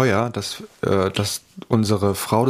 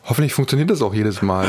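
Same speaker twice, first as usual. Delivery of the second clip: ordinary delivery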